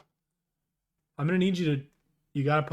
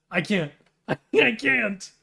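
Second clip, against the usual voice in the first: laughing; 11 semitones higher, 10 dB louder than usual. Real, not acted. Cracking up at own impression.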